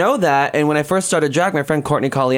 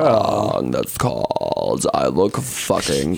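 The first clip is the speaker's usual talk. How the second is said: put-on slow, lower Valley Girl drawl; imitating Alaska; 7 semitones lower, slower than usual. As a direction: put on her lazy drawl. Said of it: "the way her, she speaks".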